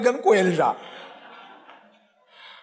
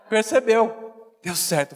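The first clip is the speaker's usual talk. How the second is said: ordinary talk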